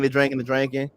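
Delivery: ordinary talk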